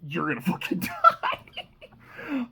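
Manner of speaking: slow threat collapsing into high laughter; laughing on the last words; breaking into laughter. Real, not acted. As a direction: start the threat, then crack up.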